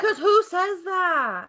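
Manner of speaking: high, loud, slow outraged question; outraged disbelief. Real, not acted. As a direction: stretch it out in disbelief. Outrage at the comment.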